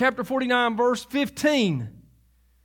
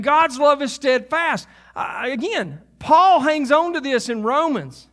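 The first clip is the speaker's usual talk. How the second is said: usual speaking voice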